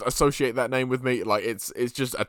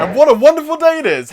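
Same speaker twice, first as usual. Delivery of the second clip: loud high excited announcement; 12 semitones higher, 11 dB louder than usual. Pretend. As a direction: open the show with a burst. Excited channel opener.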